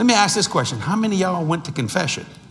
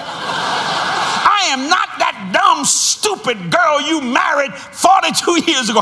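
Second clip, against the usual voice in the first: high, slow hammed-up voice of the angry wife; husband mimics his wife's angry retort in a high, slow voice; angry wife imitation; 9 semitones higher, 3 dB louder, slower than usual. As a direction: play the furious wife, pitched high and stretched out. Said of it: "She said".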